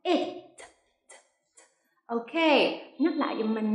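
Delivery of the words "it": "It" with a released final t; clear final consonant. Shown as a demonstration. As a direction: sound the t at the end of "It". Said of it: "lưu ý âm cuối".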